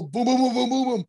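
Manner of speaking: quick mimicked banging; imitated scramble. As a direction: mimic footsteps thudding away in a hurry. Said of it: "I heard hustle, rustle".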